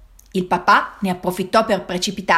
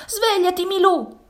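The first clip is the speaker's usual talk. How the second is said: panted plea; 12 semitones higher than usual. A narrator's reading. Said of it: "ansimò Sara".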